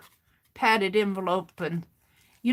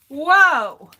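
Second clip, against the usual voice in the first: loud startled whoa; 5 semitones higher, 11 dB louder than usual. Real, not acted. Recovering from choking fit.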